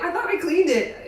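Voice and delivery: high, dopey put-on voice; clueless friend. Stoned friend explaining to his dad, high dopey voice. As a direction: sound baffled and innocent.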